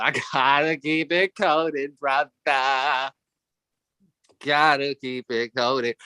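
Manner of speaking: slow, laid-back coded drawl; slow cool street voice. Retold or imitated. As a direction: keep it low-key and slow. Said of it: "I'm like".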